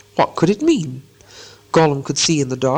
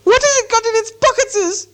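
very high, piercing creature hiss; hissing Gollum; 3 dB louder than usual. A narrator's reading. Gollum chases Bilbo from behind, hissing loudly. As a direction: screech the question with hissing s sounds. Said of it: "he heard the hiss loud".

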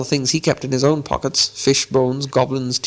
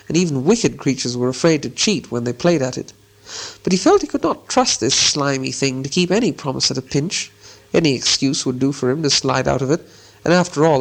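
ordinary delivery, nothing marked

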